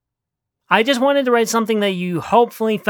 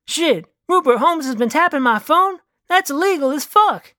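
raised-pitch, incredulous guy voice; animated character voice, indignant; indignant Patty; 8 semitones higher than usual. Acted. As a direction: protest in a high-pitched outburst. Plays Patty outraged at phone tapping.

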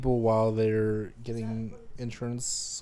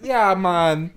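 loud mock Jamaican accent; 4 semitones higher, 7 dB louder than usual. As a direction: say it Jamaican style, playful. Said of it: "Can you do a Jamaican accent".

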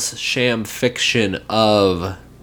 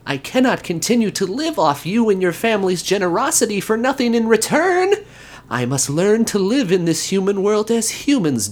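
higher, eager little elf; an elf pleading sincerely; earnest elf voice; 7 semitones higher than usual. Acted. Voices Elfo in the story.

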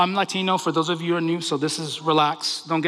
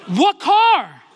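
high, loud, exasperated shout back; yelled retort; 10 semitones higher, 7 dB louder than usual. Retold or imitated. Driver answering screaming mother in the car, yelled retort. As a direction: yell it back in mock disbelief.

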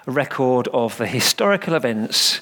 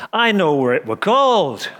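put-on high, louder little boy's voice; piping Yorkshire child; 5 semitones higher, 3 dB louder than usual. A narrator's reading. Little boy eagerly answering the vicar, piping. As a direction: pipe up like an eager little lad. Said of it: "one little boy piped up".

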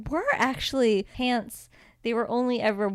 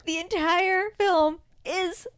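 much higher, stretched-out exasperated disbelief; incredulous rant; 10 semitones higher, slower than usual. Real, not acted. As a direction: drag it out high like you can't believe it. Ranting about actor's height.

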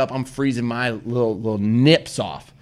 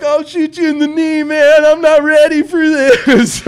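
mock panic; 10 dB louder, slower than usual. Panicked imagined threat in woods.

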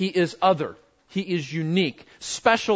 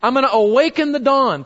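eager David; 5 semitones higher, 5 dB louder, faster than usual. Retold or imitated. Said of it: "Remember David?".

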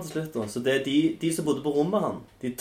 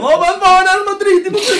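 loud furious yelling, high; angry drunk yell; 14 dB louder than usual. Retold or imitated. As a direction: bellow it like a raging drunk. Imitating the raging corporal.

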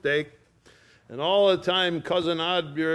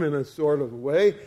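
usual speaking voice

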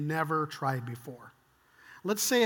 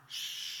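long, soft shush; a drawn-out calming hush; hushing shh; 11 dB quieter than usual. Acted. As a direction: shush them gently. God hushing terrified men.

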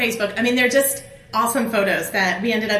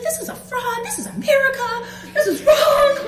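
much higher, laugh-crying wail that grows louder; mock sobbing outrage; 4 dB louder than usual. Speaker retelling her tearful outrage over the fraud, laugh-crying wail. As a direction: wail it like a half-laughing sob. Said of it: "And I am weeping, sobbing".

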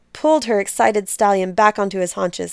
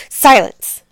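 loud, higher furious shout to cut him off; angry shout; 5 semitones higher, 9 dB louder than usual. Acted. Queen silencing the lying priest with an angry shout. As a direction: bark the word out in rage. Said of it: "Anayat grew angry".

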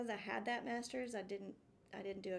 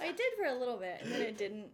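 laughing; 6 dB louder than usual. Real, not acted. Laughing while telling of failed romance.

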